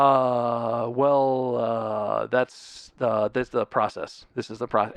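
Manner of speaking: drawn-out, low, monotone uh and well; hesitant stalling. Retold or imitated. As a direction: stall like a clueless phone agent. Stalling phone agent's excuse retold.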